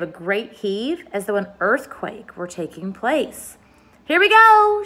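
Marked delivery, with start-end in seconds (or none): 4.0-4.8 very high, loud shout; old grasshopper shouting with excitement. A narrator's reading. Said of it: "shouted the old green grasshopper".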